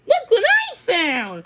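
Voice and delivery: high and thrilled, slowly savoring it; excited young horse. A narrator's reading. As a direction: show off the find with glee. Excited young horse shows find.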